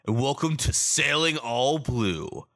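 big booming trailer narration; movie trailer voice. Acted. Podcast intro in trailer voice.

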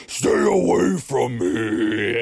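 slow, menacing mock demon voice; hint of a demon, slow; demonic voice. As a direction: drag out a lightly voiced possessed warning. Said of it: "had this crazy, deep, demonic voice".

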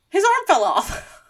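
high, loud, slowed, on the edge of laughter; amused punchline. Real, not acted. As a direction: squeal the punchline with real glee. Punchline of own toy story.

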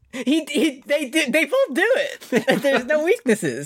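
high, slow words squeezed out through real laughter; laughing hard. Real, not acted. Cracking up over the anime.

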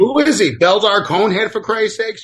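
high, loud outraged yell; exasperated shout. Real, not acted. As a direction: yell it in disbelief at the screen. Outraged at the screen.

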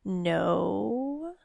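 soft, quiet demon no; the imp softly refuses the queen; very quietly. A narrator's reading. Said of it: "the imp said very quietly".

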